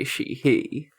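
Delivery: ordinary delivery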